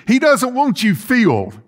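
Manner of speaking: ordinary delivery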